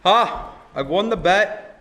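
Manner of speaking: loud, high, slow villain's boast; stagey gloat with a big "Ha!"; triumphant gloating. Acted. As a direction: crow over your victory, loud and drawn out. Villain gloats over his trap.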